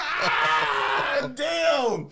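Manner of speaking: high, loud pained exclamation; stung yelp. Retold or imitated. Boy hit by a roast, loud high yelp. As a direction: react like you just got burned.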